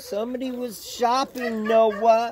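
high, fussy voice for the horses; horses' put-on grumbling, pitched high; mock horse voice. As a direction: play the horses as whiny complainers. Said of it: "gets their little".

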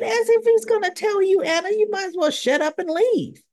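high-pitched exasperated aside; exasperated retort. Real, not acted. Viewer telling Anna not to bother, exasperated. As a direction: say it high, as if it's pointless asking.

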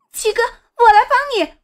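put-on high, eager girl's call; urgent young sister. A narrator's reading. Sister calls to brother as she joins the fight, urgent and high.

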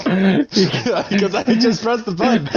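laughing. Real, not acted. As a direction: answer through real laughter. Both laughing at the mishap.

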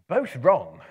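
loud triumphant cry; relieved cry. Acted. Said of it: "cried Bilbo, very much relieved".

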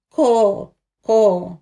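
loud imitated crow cawing. A narrator's reading. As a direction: call it out like a crow.

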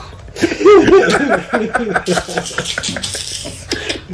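high laugh tailing downward; real drawn-out cackle; long helpless laugh. Real, not acted. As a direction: burst out and keep laughing. Cracking up over his story.

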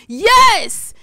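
high, very loud burst; excited recognition. Real, not acted. Vlogger recognizing the viewer's video, excited shout.

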